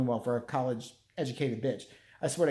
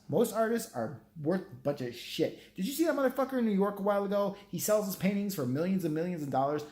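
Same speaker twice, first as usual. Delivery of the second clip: ordinary talk, nothing marked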